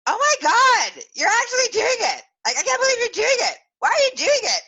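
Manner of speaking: loud, very high mock squeals; shrieking disbelief. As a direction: imitate scandalized onlookers crowding round. Retells onlookers' astonished reaction.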